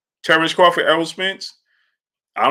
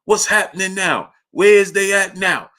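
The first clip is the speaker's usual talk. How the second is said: imitating uncle asking grandfather; 6 semitones higher than usual. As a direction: nag John for the play-by-play. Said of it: "my grandfather's name was John".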